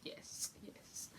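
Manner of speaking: very soft whispered yes; triumphant whisper. Retold or imitated. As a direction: hiss a quiet victory. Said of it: "and I was like".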